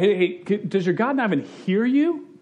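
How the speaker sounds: high, taunting question; jeering Elisha, goading the prophets; mocking. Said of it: "So he mocks them".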